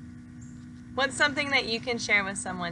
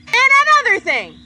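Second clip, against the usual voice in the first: louder, higher, fast outburst; pigeon fires up; 12 semitones higher, 11 dB louder, faster than usual. Acted. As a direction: launch a fresh tirade. Pigeon starts a new complaint.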